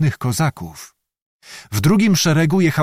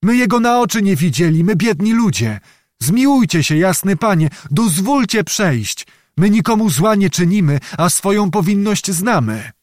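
high-pitched humble protest; pleading; 7 semitones higher than usual. A narrator's reading. Old peasant begs to be let through, pleading. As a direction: plead innocence to a lord.

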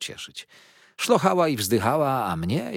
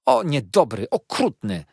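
weeping protest, louder and high; tearful cry; 4 semitones higher, 6 dB louder than usual. A narrator's reading. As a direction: Dora cries at her husband, a weepy wail. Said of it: "płakała Dora".

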